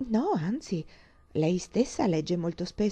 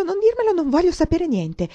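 higher excited plea; 10 semitones higher, 7 dB louder than usual. Acted. Catherine begs, repeating herself.

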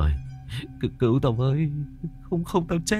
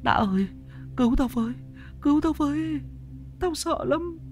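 pitched much higher, panicked and breathless; plead, nearly out of breath with fear; terrified pleading; 11 semitones higher than usual. Acted. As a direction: friend begging for rescue from a ghost doll, terrified. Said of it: "như là người sắp sửa hết hơi".

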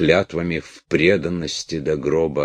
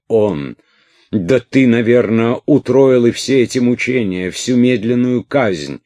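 furious; 8 semitones higher, 7 dB louder than usual. A narrator's reading. Princess accuses Orlov in fury.

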